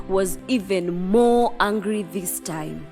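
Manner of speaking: usual speaking voice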